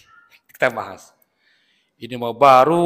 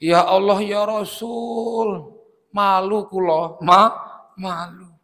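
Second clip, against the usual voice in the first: drawn-out, higher lament, acted; mock tearful; 4 semitones higher than usual. Sick man ashamed before the visiting Prophet, acted tearful lament. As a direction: plead as if weeping with shame, without real sobs. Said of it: "Langsung nangis, Pak, ndoprok".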